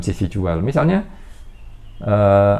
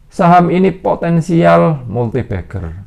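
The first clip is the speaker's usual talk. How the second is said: hyped stock tip; 8 semitones higher, 5 dB louder, slower than usual. Retold or imitated. Acting out a stock tipster's claim.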